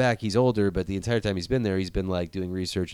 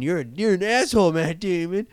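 much higher, indignant scolding; outraged scolding; 9 semitones higher than usual. Retold or imitated. Anne Hathaway calling out Matt Damon, high indignant scold. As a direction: tell him off in high disgust.

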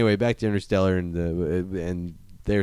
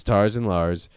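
ordinary talk, nothing marked

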